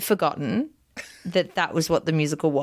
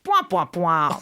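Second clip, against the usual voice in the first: mock moaning; slower than usual. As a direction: imitate a whiny old woman's tone. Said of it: "that's the tone of her lines".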